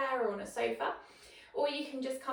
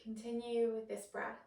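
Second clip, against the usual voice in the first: guided meditation; 4 dB quieter than usual. Acted. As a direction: guide the breath quietly, leaving space after each phrase. Leads a breathing meditation.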